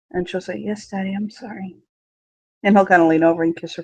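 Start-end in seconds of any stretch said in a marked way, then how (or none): 0.6-1.7 very soft, small contrite voice; meek apology. Acted. Daughter apologizing to her father, barely audible. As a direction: shrink and mumble your apology.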